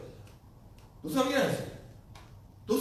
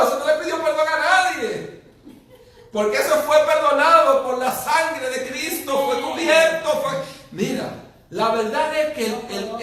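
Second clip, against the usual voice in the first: loud, higher, driving sermon voice; preaching at full force; 6 semitones higher, 7 dB louder than usual. Acted. Preacher challenging the congregation about forgiveness, loud fervent preaching. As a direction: hammer the point home to the pews.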